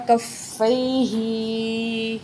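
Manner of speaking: کفیہ said very slowly, the final ہ stretched; drawn out ending. Shown as a demonstration. Teacher demonstrating the lengthened ending. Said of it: "ایک الف کے برابر ہا کو کھینچیں گے".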